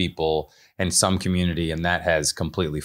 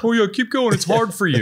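high mocking singsong voice; 11 semitones higher than usual. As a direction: sing it high like teasing the crew. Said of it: "crew is just sitting there with cameras going like".

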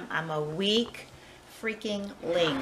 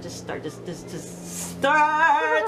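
loud, slow, high; exasperated shout; frustrated urging; 6 semitones higher, 7 dB louder, much slower than usual. Real, not acted. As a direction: push yourself to start. Urging herself to swat.

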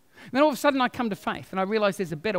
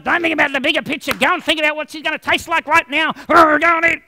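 a louder, pushy devil voice; tempting devil; 5 semitones higher, 6 dB louder than usual. Acted. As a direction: egg him on to eat the bird. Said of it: "the devil one's going".

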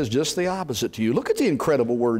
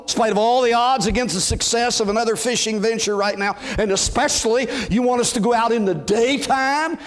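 reluctant fisherman giving in with a sigh; grudging fisherman; 6 semitones higher than usual. Shown as a demonstration. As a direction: grumble it, then climb in disbelief. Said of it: "you might hear him say something like this".

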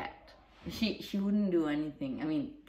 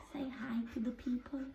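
softly whispered to the puppy; 5 semitones higher, 12 dB quieter than usual. Real, not acted. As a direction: coax the dog in a whisper. Coaxing dog to greet viewers.

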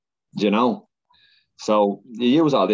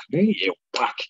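much higher-pitched mock call to get moving; mock urging; 6 semitones higher than usual. Runner jolting himself out of moaning, high mock urging. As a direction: urge them up in a high put-on voice. Telling himself off amid moaners.

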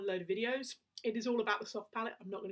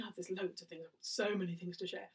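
ordinary talk, nothing marked

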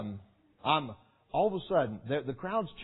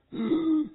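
gasp; 14 semitones higher, 4 dB louder than usual. Retold or imitated. Out of breath near the finish.

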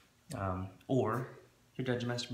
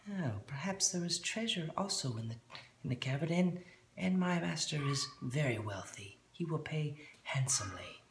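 put-on gnome accent; 7 semitones higher than usual. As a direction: hush it like a sly little gnome. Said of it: "gnome voice again".

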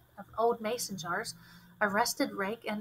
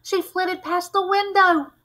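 fearful; 9 semitones higher, 7 dB louder than usual. A narrator's reading. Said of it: "his eyes glassy with fear".

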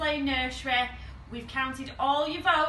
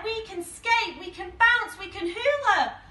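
high, loud, eager list; excited; 9 semitones higher, 5 dB louder than usual. Acted. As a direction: rattle off the plans with delight. Simon thrilled at finding partner.

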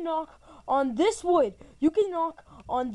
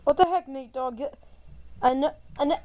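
normal talking voice

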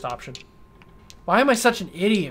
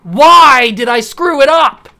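much higher, much louder, slow self-blaming shout; anguished yell; 8 semitones higher, 12 dB louder, slower than usual. Real, not acted. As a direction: scream it at yourself. Missed draft pick, outburst.

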